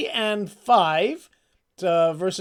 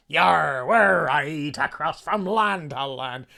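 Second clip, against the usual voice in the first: sung with a hammed-up Irish pirate lilt, louder; Irish sea-shanty lilt; 5 dB louder, slower than usual. Shown as a demonstration. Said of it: "a little Irish lilt in your voice".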